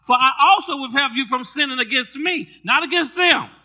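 loud, high, fervent preaching with the congregation; full-force preaching. Acted. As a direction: preach it hard, building to shouts.

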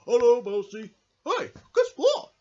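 high, loud and eager hello; excited greeting. Acted. Bear puppet excited greeting.

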